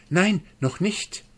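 high-pitched plea to hold off; pleading. A narrator's reading. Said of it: "bat die Gans".

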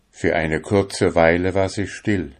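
plain reading voice

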